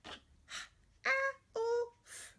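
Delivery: put-on high childlike letters; child spelling aloud. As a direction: spell like a little student. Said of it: "Say it while you write it".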